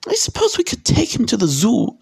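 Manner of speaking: high woman's voice, thinking aloud; high, grudging aunt character; reluctant aunt. A narrator's reading. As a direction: muse reluctantly in a high pitch. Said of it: "said Aunt Petunia slowly".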